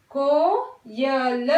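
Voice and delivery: कोयल very slowly, with a clear long o; correct pronunciation. Shown as a demonstration. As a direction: stretch out कोयल, stressing को. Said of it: "हम कहाँ पे pronounce कर रहे हैं". (सही उच्चारण कोयल, धीरे)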